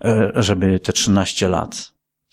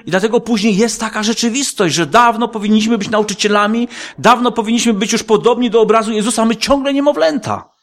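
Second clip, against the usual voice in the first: fiery preaching; 10 semitones higher than usual. Acted. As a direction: preach it hard, lingering on niepotrzebne. Preaching at full force.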